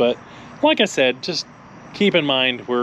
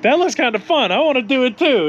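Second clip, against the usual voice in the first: mock eager friend; 11 semitones higher, 3 dB louder than usual. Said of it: "he's like".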